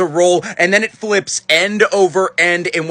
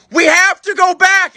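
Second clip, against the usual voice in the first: high, loud, drawn-out imitation; exasperated insistence; 11 semitones higher, 5 dB louder, slower than usual. Mom after her brother throws up, insistent cry. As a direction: push it high and loud, slowly.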